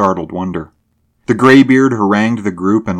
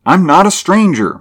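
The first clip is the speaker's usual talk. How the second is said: much higher, louder insistence; insistent repeat; 11 semitones higher, 6 dB louder than usual. A narrator's reading. Driver repeats his claim to the bearded man, high and insistent. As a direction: repeat it with voice pitched right up. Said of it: "Mulvaney repeated".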